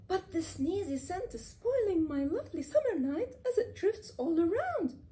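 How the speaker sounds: lightly voiced annoyed cat; cat's grumbling thought. A narrator's reading. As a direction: complain like a sneezy cat. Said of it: "Harun thinks".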